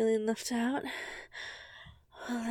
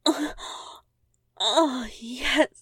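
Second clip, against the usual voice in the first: moaning; 7 dB louder, much slower than usual. Acted. Cry of pleasure.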